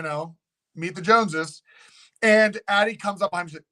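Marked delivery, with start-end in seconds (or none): none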